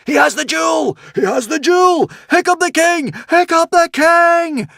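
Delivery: a crowd roaring in triumph. Acted. The crowd cheering Hiccup as king, roaring. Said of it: "roared the crowd".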